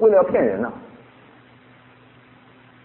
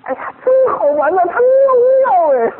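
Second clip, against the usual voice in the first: much higher, louder thrilled squeal; retold giddy excitement, high and bright; excited mother; 4 dB louder than usual. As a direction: gush like a delighted new mom. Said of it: "她说".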